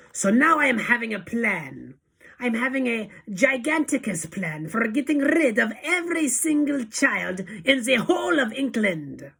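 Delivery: high, forceful proclamation; Grand High Witch bark. A narrator's reading. Witch leader announcing her plan, high barking voice. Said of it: "barked the Grand High Witch".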